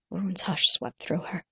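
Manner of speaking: ordinary delivery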